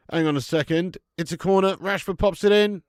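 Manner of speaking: loud, urgent commentary; live play-by-play. Real, not acted. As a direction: break off to call the corner.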